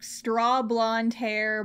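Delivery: usual speaking voice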